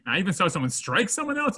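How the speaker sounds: ordinary talk, nothing marked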